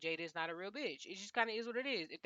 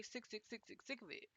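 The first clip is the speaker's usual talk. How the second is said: much softer, repeating sick; mumbled under the breath; muttered repetition; 14 dB quieter than usual. Real, not acted. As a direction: trail off muttering it over and over. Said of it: "Can I say that".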